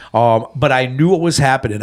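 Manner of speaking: ordinary delivery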